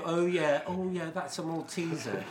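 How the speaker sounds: put-on pleasure of recognition; savouring connoisseur. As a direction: play a joke taster delighted by the find. Joke character savouring second sweet.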